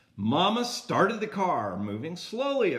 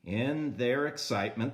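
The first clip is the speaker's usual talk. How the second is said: ordinary delivery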